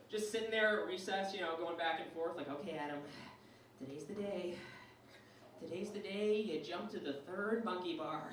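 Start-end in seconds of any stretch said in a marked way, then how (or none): 2.5-8.2 hushed, low pep talk to oneself; anxious self-talk. Acted. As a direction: psych yourself up under your breath. Anxious child psyching himself up.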